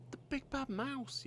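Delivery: put-on high, slow, terrified child's cry; frightened yell. Gruffalo's child seeing the shadow, frightened high yell. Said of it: "yelled the Gruffalo's child".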